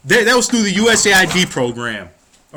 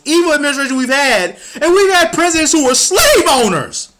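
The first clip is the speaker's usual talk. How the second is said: real anger; 7 semitones higher than usual. Real, not acted. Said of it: "I don't apologize for my fucking anger".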